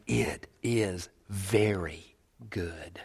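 very slow, soft and weighted; hushed approval. Acted. God rejoicing over the new creation, hushed approval. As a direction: space out each word softly. God's approval voiced slowly, softly.

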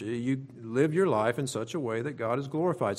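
normal talking voice

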